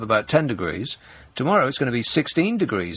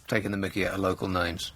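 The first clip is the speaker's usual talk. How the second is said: ordinary talk, nothing marked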